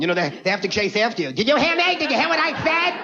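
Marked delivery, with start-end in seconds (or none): 1.3-2.9 high, pestering mock girlfriend voice; hammed-up demanding partner, pitched up; mock nagging girlfriend. Girlfriend chasing him out of the room, mock pestering high voice.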